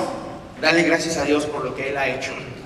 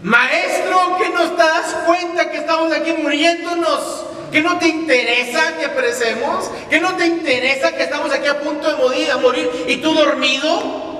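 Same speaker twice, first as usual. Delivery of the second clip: high, loud frantic cry for help; panicked shouting plea; 11 semitones higher, 4 dB louder than usual. Acted. Terrified disciples shouting at sleeping Jesus in storm. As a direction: yell it like the boat is sinking.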